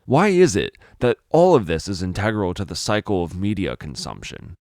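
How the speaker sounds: plain reading voice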